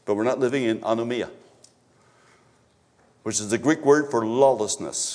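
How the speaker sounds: normal talking voice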